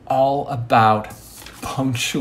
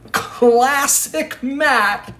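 high and slow, drawn out "Classic Matt!"; high slow self-praise; 12 semitones higher, 3 dB louder, much slower than usual. Acted. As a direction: cheer for yourself in a high, slow voice. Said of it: "self-congratulation".

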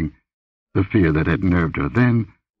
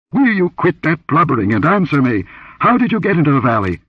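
high-pitched, exasperated demand; impatient anger; 9 semitones higher, 4 dB louder than usual. A narrator's reading. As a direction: snap at the blubbering girl for an answer. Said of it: "With an impatient imprecation".

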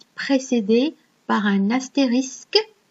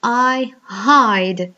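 louder, English accent with a clear breathy "h" in "I hide"; English pronunciation; 5 dB louder than usual. Shown as a demonstration. French teacher showing pupils the English h, in English accent. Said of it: "vous dites par exemple".

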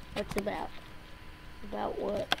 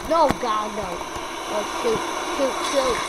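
loud gamer panic; alarmed outburst; 7 dB louder than usual. Real, not acted. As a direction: cry out as it all slips away. Losing control in the game.

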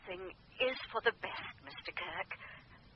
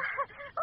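high giggle. Acted. Medium laughs on the phone.

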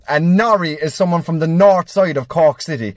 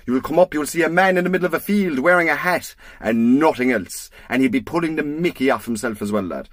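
ordinary talk

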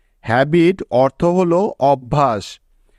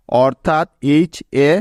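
ordinary delivery

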